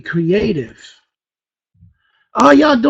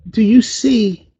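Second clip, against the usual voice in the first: ordinary delivery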